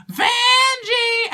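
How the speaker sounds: loud, high drawn-out yell. Retold or imitated. Retelling two queens' safe word gone wrong, yelled high. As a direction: shriek the name high and long. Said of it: "they just start yelling".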